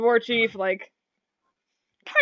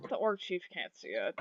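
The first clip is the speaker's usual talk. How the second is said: sighing; 3 semitones lower, 11 dB quieter than usual. Acted. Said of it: "sighs and he goes".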